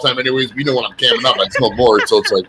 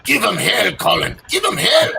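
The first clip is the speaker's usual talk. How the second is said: Father Jones; 6 semitones higher, 3 dB quieter than usual. Retold or imitated. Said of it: "like Father Jones used to tell me".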